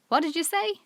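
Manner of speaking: the deaf woman fails to hear Dick. A narrator's reading. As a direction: ask again, straining to hear. Deaf woman asking again.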